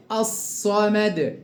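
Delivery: الصمد slowly with a long emphatic double s; lam merged into s. Shown as a demonstration. Teacher shows the merging, slow doubled emphatic s. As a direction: hold the ص in الصمد, lam silent. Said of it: "أضغمت اللام في الصاد".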